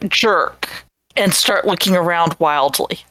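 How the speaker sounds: usual speaking voice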